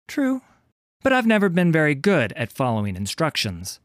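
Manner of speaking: high, breezy admission; high-pitched, coolly taunting pirate woman; mocking bounty hunter. A narrator's reading. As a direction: concede it airily in a high, strong voice. Heroine mocks before the kill.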